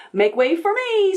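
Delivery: high squealing pig voice. A narrator's reading. Pig three barging into the tub, high squeal. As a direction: squeal it out pushily. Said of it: "squealed number three".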